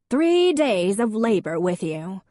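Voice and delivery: slow, high, loud reproach, x0.7; grandmother reproaching. A narrator's reading. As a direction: guilt her son. Grandmother scolds her son.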